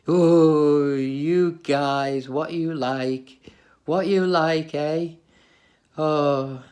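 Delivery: loud, drawn-out mock-exasperated mum; big affectionate groan at the kids; fond mum exclaiming. Mum reacting to the kids' silly joke, loud fond exasperation. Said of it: "she'd be like".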